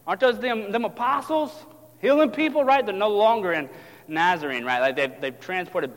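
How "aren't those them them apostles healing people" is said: high-pitched question; curious onlookers. Acted. Onlookers recognizing the apostles, high curious questioning. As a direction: voice a bystander piecing it together. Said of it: "the folks on the shoreline say".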